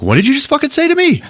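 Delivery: very high, quick affronted squawk; mock outrage. Thor reacting to Hulk's insult, mock outrage. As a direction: fire it back high and fast.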